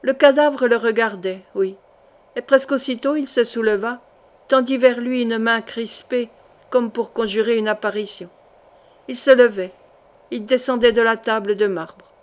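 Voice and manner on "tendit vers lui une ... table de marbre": ordinary delivery, nothing marked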